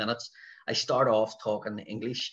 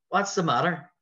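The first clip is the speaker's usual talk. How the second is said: retold calling out; 7 semitones higher, 5 dB louder than usual. Comedian stops set, calls to crowd.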